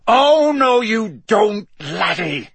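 high, very loud roar falling on the last word. A narrator's reading. Moody stopping an attack in the entrance hall, roaring. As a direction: roar it out to stop him. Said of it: "a roar that echoed".

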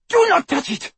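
high, frantic shrieked warning. A narrator's reading. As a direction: shriek in panic, pitch way up. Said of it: "shrieked Bellatrix".

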